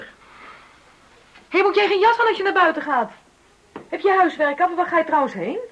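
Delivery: put-on loud, high, rapid questions at the kid; nagging mother. Mother to child leaving the house, loud nagging questions. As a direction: fire off questions to get the child off.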